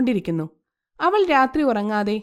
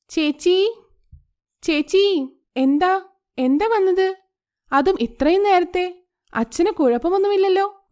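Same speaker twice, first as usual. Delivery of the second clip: higher, anxious questions; surprised older sister, voice raised high; alarmed sister; 7 semitones higher than usual. A narrator's reading. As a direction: ask in alarm why she came so early. Worried sister at dawn.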